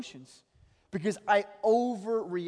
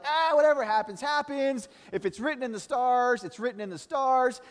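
laid-back shrug; 8 semitones higher, 5 dB louder than usual. Pretend. Pastor mocking the laid-back listener, high and carefree. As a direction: brush it all off, whatever happens. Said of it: "Some of us are like".

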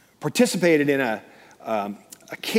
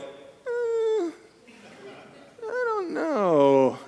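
high, small, drawn-out hesitation; reluctant wavering; much slower than usual. Retold or imitated. Climber looks down from the platform, reluctant. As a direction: stretch it out in a high, hesitant whine. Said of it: "they go like".

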